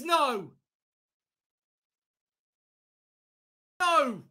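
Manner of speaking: high pleading cries of no; desperate shouting. Real, not acted. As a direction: beg the goal not to count. Fearing a late equaliser.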